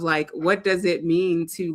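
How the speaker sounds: ordinary delivery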